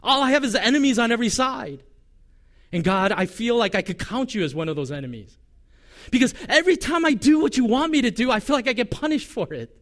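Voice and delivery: high, loud cry of frustration; impassioned lament. Retold or imitated. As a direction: pour out the complaint to God. Jeremiah's honest complaint voiced.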